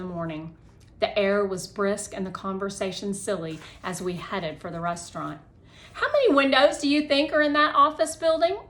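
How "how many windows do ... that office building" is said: loud, high, fast call; shouted question. Acted. Connor shouting to friends on the walk, loud call. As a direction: call it out across the street. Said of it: "Connor shouted".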